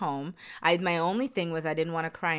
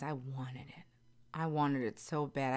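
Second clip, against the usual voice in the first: whispered longing; 3 semitones lower, 7 dB quieter than usual. Real, not acted. Confessing how badly she wanted it.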